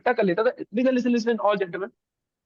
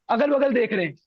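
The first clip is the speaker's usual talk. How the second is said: usual speaking voice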